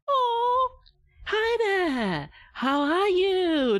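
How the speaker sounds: put-on baby talk. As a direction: coo at a cute little baby, high and drawn out. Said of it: "you're seeing a cute little baby".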